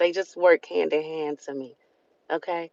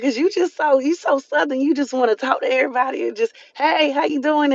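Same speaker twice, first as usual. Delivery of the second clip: higher, teasing voice of her sister; imitating sister; 7 semitones higher than usual. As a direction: mimic her sister poking fun. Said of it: "She like".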